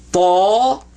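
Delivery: tall held long, rising in pitch; drawn-out demonstration. Shown as a demonstration. Teacher gets student to say it, drawn-out "tall" rising high. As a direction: stretch the vowel of "tall" upward.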